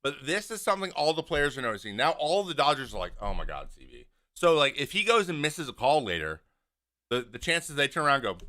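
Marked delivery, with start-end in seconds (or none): 3.2-4.1 low, soft imitated exasperation; exasperated. Players reacting to the umpire's antics, exasperated. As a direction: drop it under your breath, fed up.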